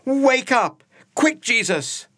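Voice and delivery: high, loud urgent shout to wake him; panicked shouting. Acted. Terrified friends shouting at sleeping Jesus, urgent yell. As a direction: yell to rouse a sleeper mid-storm.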